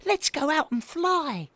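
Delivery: put-on high, eager baby owl; thrilled little owl; excited. A narrator's reading. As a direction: urge him eagerly in a high voice. Excited Baby Owl urges brother.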